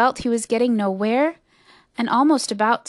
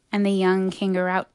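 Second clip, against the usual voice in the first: ordinary delivery, nothing marked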